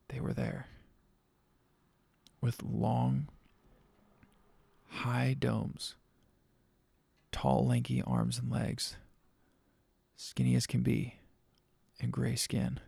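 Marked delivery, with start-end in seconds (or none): none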